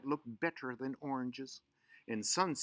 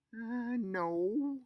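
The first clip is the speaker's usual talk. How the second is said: guilty denial; 11 semitones higher than usual. Acted. Bridget lying to her mother.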